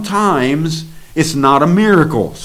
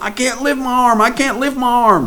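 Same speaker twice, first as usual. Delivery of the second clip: high, pleading mock protest, much higher; imitating the man; 12 semitones higher than usual. Preacher mimics the man who can't lift his arm, high and pleading. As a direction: squeak out his worried insistence.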